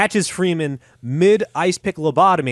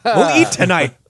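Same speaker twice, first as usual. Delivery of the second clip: higher, louder laughing cheer; joking with a laugh; 8 semitones higher, 6 dB louder than usual. Real, not acted. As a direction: crack up mid line. Laughing joke.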